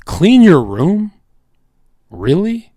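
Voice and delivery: high, drawn-out disbelieving question; incredulous. Acted. Incredulous at the quoted advice.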